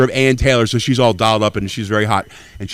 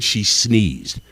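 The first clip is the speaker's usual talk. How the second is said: usual speaking voice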